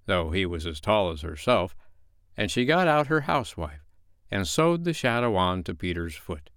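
ordinary delivery, nothing marked

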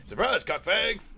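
quoting a soldier's gleeful battle taunt; quoting Chris. Retold or imitated. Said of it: "he's got a line where he goes".

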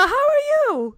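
high, loud, overbright hello in English; loud eager greeting. Retold or imitated. Greeting her Spanish-speaking date in English, loud and high. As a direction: throw a big eager how are you at him.

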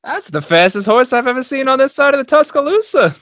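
put-on high, amazed old-time announcer; bewildered announcer marvelling at the horses; race announcer. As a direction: call the race like a baffled showman. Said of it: "announcing, like, a race".

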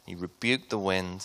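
ordinary talk

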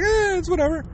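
high, dismissive shrug of a voice; lazy mocking shrug; mock indifference. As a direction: brush it off like nothing matters. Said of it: "supposed to be like".

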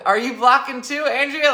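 high, loud call across room. Real, not acted. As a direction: call out to a coworker. Calling out to coworker loudly.

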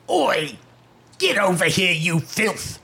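rough London orc accent; Cockney orc guard. Shown as a demonstration. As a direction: bark it like a London thug. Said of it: "No, like a London accent".

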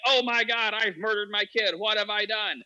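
high-pitched, hammed-up panic; mock freaking out. Mother realizing what she did after the abortion, acted panic. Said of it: "she's freaking out 'cause she's like".

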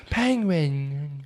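"Penguin" mispronounced as "pengwing"; mock British fumble of "Penguin"; Benedict Cumberbatch. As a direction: botch "Penguin" with a drawn-out "-wing" ending. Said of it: "would have been Benedict Cumberbatch doing".

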